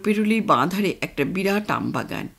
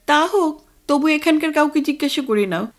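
nagging wife; 6 semitones higher, 3 dB louder than usual. Acted. Wife nags husband about directions.

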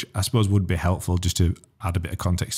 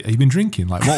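retold amazed question; 5 semitones higher, 4 dB louder than usual. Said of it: "because I said to you".

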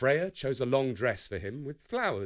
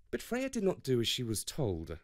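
plain reading voice

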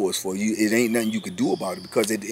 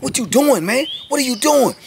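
captain's shout; 13 semitones higher than usual. Retold or imitated. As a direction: confront the sergeant head-on. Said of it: "He was like".

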